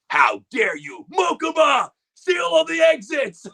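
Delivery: high, loud commanding shout, slow on the final order; Seto Kaiba. Retold or imitated. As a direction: bark orders like an outraged anime CEO. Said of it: "He's like".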